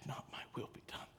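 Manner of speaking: soft whispered surrender; whispered prayer. Acted. Jesus facing death, yielding to the Father in a whisper. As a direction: breathe the words out in a whisper. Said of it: "he said".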